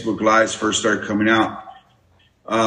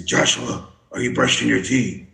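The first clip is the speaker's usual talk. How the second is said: half-whispered Batman asking grandson; lightly done Batman, hushed. Retold or imitated. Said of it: "for my grandson".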